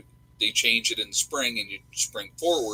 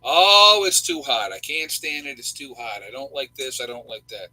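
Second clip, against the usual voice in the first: complaining and moaning; 8 dB louder than usual. Acted. Host mimics people griping about hot weather, mock complaining. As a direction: imitate a whiner, big wailed opening then grumbling on. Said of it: "pissing and moaning".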